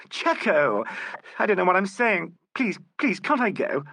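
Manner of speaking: high, desperate begging; pleading in distress. A narrator's reading. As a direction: beg to be let go, near tears. Distressed woman begging to leave.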